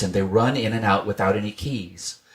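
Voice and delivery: ordinary delivery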